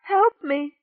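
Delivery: high, loud tearful plea; pleading through tears. Acted. Rachel begging Amos for help, weeping. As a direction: beg him through weeping. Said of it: "she pleaded, weeping".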